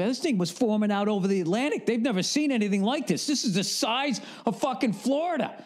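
imitating a friend's worked-up warning; alarmed informed guy. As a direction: rattle off alarming facts like a know-it-all. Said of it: "somebody who's fucking informed".

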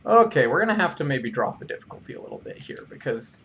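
ordinary delivery

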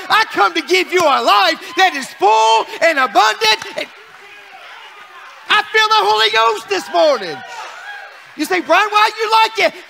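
shouted, high preaching cry; preaching at full force. Acted. Pastor preaching happy to the congregation, shouting. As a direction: lift the church with a full-voiced shout.

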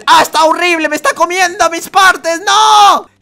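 loud high yell of alarm; real gamer panic; panicked shout. Real, not acted. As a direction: shout as you get eaten. Monster eating him.

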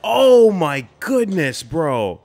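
slow, high, drawn-out gasps of disbelief; aghast. Real, not acted. Viewer stunned by a killing, slow high disbelief. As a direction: let each one stretch out in awe.

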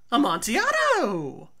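loud, high cry of the wine's name; excited drunk Fortunato. Acted. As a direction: shout it out, hooked on the wine.

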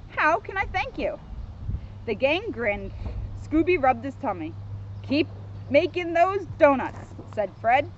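measured, lively storybook narration; children's story reading. A narrator's reading. Officer reads Scooby book to kids, storybook narration. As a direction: read it out clearly for small listeners.

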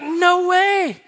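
high, loud, thrilled disbelief; retold amazed cry. Reacting to friend's engagement news.

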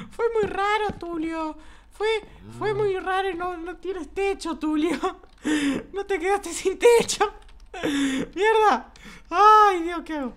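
very high, loud squeaky voice; squeaky cartoon voice. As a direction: chatter like a silly cartoon critter. Squeaky voice teasing Tulio.

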